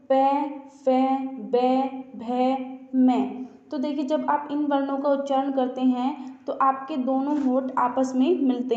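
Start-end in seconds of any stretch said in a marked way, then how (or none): 0.1-3.2 "पै, फै, बै, भै, मै" said slowly, each syllable set apart and clearly spaced; slow letter recital. Shown as a demonstration. Teacher to students, reciting the पै वर्ग slowly and deliberately. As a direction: spell the sounds out slowly for the class. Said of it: "अब देखिए पै वर्ग की बात करते हैं".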